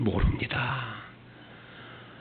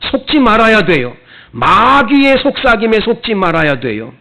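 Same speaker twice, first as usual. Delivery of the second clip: louder, higher, driving sermon voice; full-force preaching; 4 semitones higher, 6 dB louder than usual. Acted. Pastor urging the congregation about God's love, loud fervent preaching. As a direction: preach it out with fervor.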